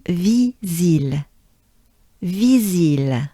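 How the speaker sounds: "visil" with two smiling i sounds; French pronunciation. Shown as a demonstration. Teacher drilling "visil" with a banana smile. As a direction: smile wide through both i's of "visil". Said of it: "two banana smiles".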